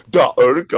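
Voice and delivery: "Urge" as loud "OOR-kah"; high, overdone German "Urge"; attempted German pronunciation. Shown as a demonstration. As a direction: punch out "Urge" in two hard syllables.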